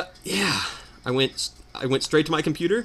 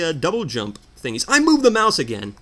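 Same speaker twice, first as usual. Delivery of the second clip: normal talking voice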